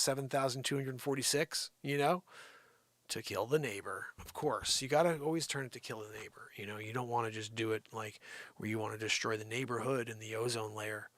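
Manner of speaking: normal talking voice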